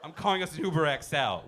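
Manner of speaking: normal talking voice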